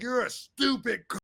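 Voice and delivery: high, strained insult at normal volume; exaggerated venom, held back in volume; mock hostile. Shown as a demonstration. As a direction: lean nastily on the insult. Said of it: "I don't talk like".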